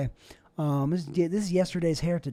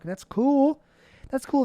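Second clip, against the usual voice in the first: higher, brighter sarcastic that's cool; mock enthusiasm; 7 semitones higher, slower than usual. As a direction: pretend to be impressed.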